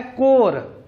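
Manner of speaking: कोर said core, p and s silent. Shown as a demonstration. Teacher shows corps to pupils, silent p and s. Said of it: "पी और एस दोनों silent हैं".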